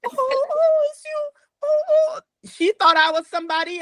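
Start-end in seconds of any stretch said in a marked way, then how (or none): 0.1-2.2 high-pitched imitation of tearful cooing; mock remorseful wail. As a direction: imitate someone wailing as they realize their mistake. Retells resident's remorseful wail after slap.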